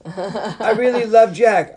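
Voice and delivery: loud, high and drawn out; mock lovesick declaration. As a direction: gush it in exaggerated, slow adoration. Acting the wishy-washy woman declaring love.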